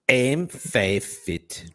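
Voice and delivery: slow, drawn-out Belfast "I'm five feet"; Northern Irish accent. Retold or imitated. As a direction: imitate a Belfast woman saying it slowly. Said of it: "Very first thing she ever said to me".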